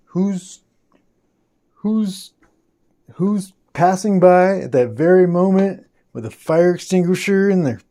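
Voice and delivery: high voice, fighting back laughter and tears; overcome, incredulous. Real, not acted. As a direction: build from halting starts to loud amazement. Overcome telling of the rescuer.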